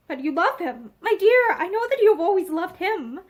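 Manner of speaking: ordinary delivery, nothing marked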